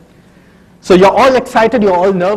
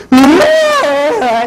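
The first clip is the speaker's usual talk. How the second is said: put-on high burst of a little girl starting to cry; child's crying wail; 7 semitones higher than usual. Daughter bursts into a mock cry after being scolded. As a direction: wail like a hurt eight-year-old. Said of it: "suddenly she goes".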